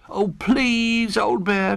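high, drawn-out please; pleading cry. A narrator's reading. Little Bear pleads with Old Bear for the tent. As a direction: beg with all his heart. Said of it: "cried Little Bear".

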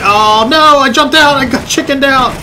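high, loud outcry; high-pitched flustered exclaiming; alarmed excitement. Real, not acted. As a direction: blurt in a panic while bailing out. Bails out before the crash.

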